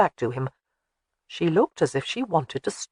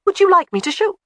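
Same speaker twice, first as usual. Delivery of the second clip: eager; 6 dB louder than usual. A narrator's reading. Jamie offers eagerly.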